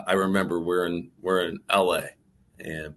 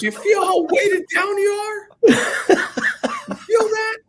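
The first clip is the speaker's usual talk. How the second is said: much higher, louder, laughing mock shout, very slow at the end; the mentor teasing with a shrill stretched shout; imitating Ken shouting; 8 dB louder, slower than usual. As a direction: hammer the point home in a high put-on yell. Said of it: "Ken's got this great voice".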